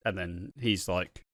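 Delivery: ordinary talk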